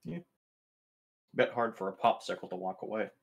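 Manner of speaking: normal talking voice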